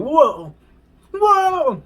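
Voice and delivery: rising high, loud whoas; alarmed whoa. Retold or imitated. Passenger reliving the crazy turbulence, high alarmed whoas. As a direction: ride the turbulence with each whoa.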